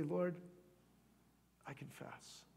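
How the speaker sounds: hushed, falling almost to breath; soft, humble admission with a pause; prayerful confession. Acted. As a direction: confess it very softly to God.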